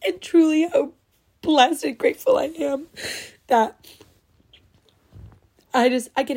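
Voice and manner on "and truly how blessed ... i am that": higher, tearful and breaking; overwhelmed, crying while thanking listeners; crying happy tears. Real, not acted. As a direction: push through real happy tears. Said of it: "literally crying".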